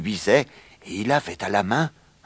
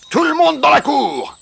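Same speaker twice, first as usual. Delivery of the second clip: very high, loud summons; shouted order; 7 dB louder than usual. A narrator's reading. Rooster summons all the hens to the yard, shouting. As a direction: yell for everyone to assemble. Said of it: "cria-t-il".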